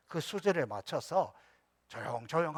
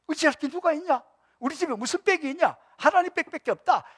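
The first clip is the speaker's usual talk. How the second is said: hearty cheer; 12 semitones higher, 6 dB louder than usual. Retold or imitated. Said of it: "마음이 좋을 때".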